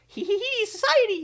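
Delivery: very high, gleeful cackling squeal; giggling Joker. Acted. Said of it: "he's like".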